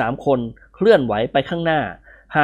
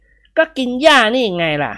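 higher, slow retort; character voice; 9 semitones higher, slower than usual. A narrator's reading. Friend telling him to eat grass, higher voice. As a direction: answer back in a higher voice.

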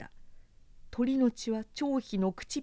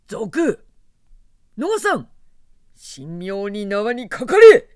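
shouting; 3 semitones higher, 14 dB louder, slower than usual. A narrator's reading. Officer shouts arrest order.

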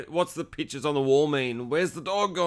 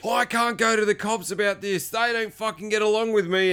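high, agitated mock protest; strained retold anger at ordinary volume; gone psycho; 6 semitones higher than usual. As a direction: imitate a worked-up man refusing. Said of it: "he's just gone psycho".

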